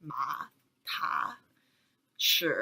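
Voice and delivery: MA, TA, SHI low and guttural; third tone. Shown as a demonstration. Teacher using other syllables for third tone, low and guttural. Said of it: "it's kind of like guttural".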